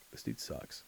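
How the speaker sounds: breathy whisper, deadpan verdict; whispered aside. Retold or imitated. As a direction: mutter it under your breath. Own thought voiced, unvoiced.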